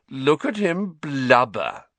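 slow, sneering mock; drawn-out schoolboy taunt; jeering. Malfoy jeering.

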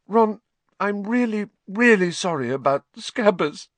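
high, tearful apology; a mild sob in a high girl's voice; sobbing. Acted. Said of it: "she sobbed".

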